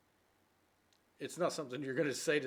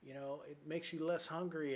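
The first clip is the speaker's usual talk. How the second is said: higher, eager explaining; enthusiastic girlfriend; 5 semitones higher than usual. Acted. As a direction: reel off the diet's benefits keenly. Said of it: "she does all of this reading and say".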